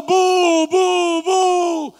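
high, loud mock boos; booing crowd. Preacher retelling how everyone booed, loud mock boos. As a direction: boo like the whole stadium.

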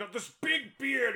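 high-pitched, playful booming-actor send-up; hammed-up boisterous personality; boisterous actor impression. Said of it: "boisterous personality".

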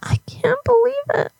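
high, whispery mock sob; fake crying. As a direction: squeak it out softly as if too upset to speak. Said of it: "I can fake cry".

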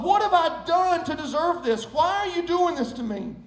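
high, dramatic plea from suffering; hammed-up distressed questioning; anguished protest. Clay pleads inside the hot oven.

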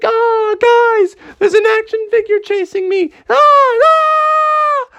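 high-pitched screaming alarm; very scared. Acted. Froggy warning everyone he is being chased, terrified screaming. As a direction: shriek for help in hammed-up terror. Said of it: "Froggy was so scared".

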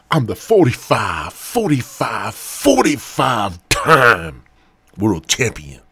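loud, drawn-out wrestling champion boast; Ric Flair. Acted. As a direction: build to a big shout on the title. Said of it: "I'm like the Ric Flair".

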